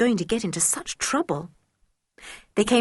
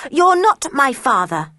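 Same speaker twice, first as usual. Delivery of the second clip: defiant; 6 semitones higher, 6 dB louder than usual. A narrator's reading. George defiant reply.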